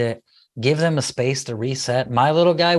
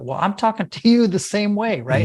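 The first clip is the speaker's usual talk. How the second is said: mock sarcastic retort; 8 semitones higher than usual. Mock parent snapping back at a yelling kid, sarcastic. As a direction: throw the angry tone back mockingly. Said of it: "I'm like".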